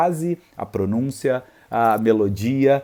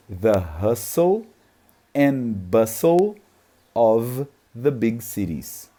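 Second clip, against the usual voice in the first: slow, each word set apart with long pauses; syllable-by-syllable English drill; 4 semitones lower, much slower than usual. Shown as a demonstration. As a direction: break the sentence into single words, slowly. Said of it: "Eu peguei palavra por palavra e eu falei cada uma delas separadamente".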